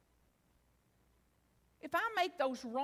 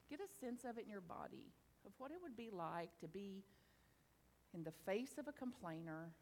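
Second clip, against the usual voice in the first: guided meditation; 7 semitones lower, 16 dB quieter than usual. Real, not acted. Speaker guiding the audience into reflection, soft meditation voice. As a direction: ease them gently inward.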